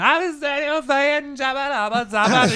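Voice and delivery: high, loud mocking babble of a drunk woman. Comic mocks the drunk heckler, high nagging babble. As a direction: sneer out her chatter in a high whiny voice. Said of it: "she's just going".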